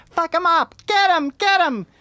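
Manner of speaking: high, strained crowd yelling; shrill women yelling. Retold or imitated. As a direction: shriek it like women in a mob. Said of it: "These women voices from behind the crowd".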